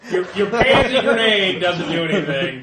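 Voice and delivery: loud, laughing through the ruling. Real, not acted. GM laughs while giving the ruling.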